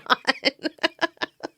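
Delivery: high, soft giggling fit; helpless high giggles; laugh. Real, not acted. Podcaster cracking up at own ghost impression, giggling.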